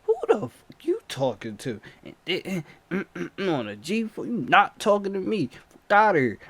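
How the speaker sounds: loud, aggressive confrontation; street fury. Retold or imitated. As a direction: cousin squaring up to the attacker.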